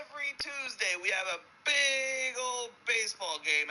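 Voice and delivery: high, hollered announcement of a treat; hammed-up high enthusiasm; hyped-up convict. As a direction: sing out the good news, stretching words. Convict selling prison life in joke.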